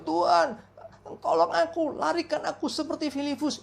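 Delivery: high, pleading plea, half-hammed; mock desperate prayer. Late traveller praying for rescue, mock pleading. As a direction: beg God to whisk him away. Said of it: "Dia doa".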